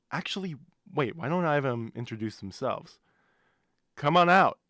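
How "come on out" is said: loud summons; calling out. Acted. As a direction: call them out loud. Calling the spirit out.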